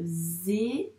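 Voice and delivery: "Zéro" split widely, zé... ro; syllabes détachées. Shown as a demonstration. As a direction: say "Zéro" as two separate held syllables with a gap. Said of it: "pour ces deux syllabes". (« Zéro » découpé lentement en zé, ro)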